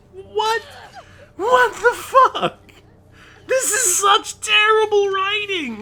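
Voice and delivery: high, slow, drawn-out shout; real outrage at terrible writing; outraged disbelief. Real, not acted. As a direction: stretch each word out in loud exasperation.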